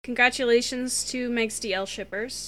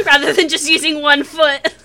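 loud, breaking into laughter; laughing; 4 semitones higher, 11 dB louder than usual. Real, not acted. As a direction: laugh through the words. Laughing at the image.

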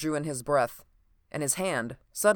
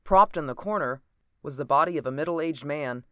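plain reading voice

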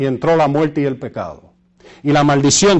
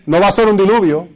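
usual speaking voice

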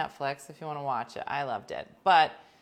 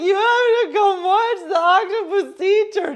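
much higher and louder, crying through a laugh; sob-laughing plea; 14 dB louder than usual. Retold or imitated. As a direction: beg them to watch while half sobbing, half laughing. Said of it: "I'm hysterical crying".

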